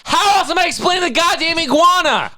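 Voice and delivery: very high, loud squeaky outcry; mock exasperation. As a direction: shriek it in fake disbelief. Exaggerated exasperated retort.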